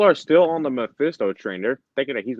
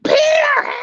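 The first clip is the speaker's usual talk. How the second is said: shrieked name, far higher and louder; mock damsel scream; 6 dB louder than usual. Mary Jane calling Peter in distress, shrieking. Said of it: "all she's gonna be like is like".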